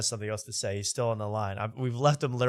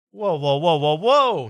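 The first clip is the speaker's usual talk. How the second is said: loud startled whoa; sudden loud whoa; alarmed surprise; 4 semitones higher, 8 dB louder, slower than usual. Real, not acted. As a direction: stop everything in alarm. Video went private.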